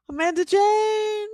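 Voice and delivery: high, surprised cry of a name; excited gasp. Real, not acted. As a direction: announce the winner with a delighted gasp. A gasp as the winner's name appears.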